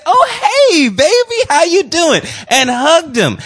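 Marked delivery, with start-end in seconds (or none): 0.1-2.2 put-on high, gushing hey baby; sweet Miss Tina greeting. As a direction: imitate her sweet welcome. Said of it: "she said".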